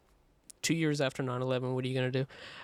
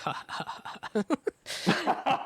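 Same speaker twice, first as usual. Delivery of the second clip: mock laugh; 14 semitones higher, slower than usual. Imitated laugh at song.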